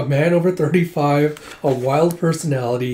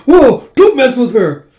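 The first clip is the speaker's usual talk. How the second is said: slow, high, loud mock alarm; alarmed exclamation; 10 semitones higher, 10 dB louder, slower than usual. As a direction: back off loudly in comic alarm. Acted alarm after her confession.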